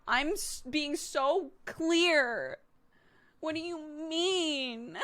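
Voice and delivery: high, very drawn-out wail; indignant toddler. Retold or imitated. Toddler insisting to mum she is clear, indignant whine. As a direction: imitate a toddler's exasperated whine, stretching each word. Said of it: "that makes them mad".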